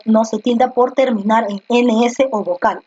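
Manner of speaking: usual speaking voice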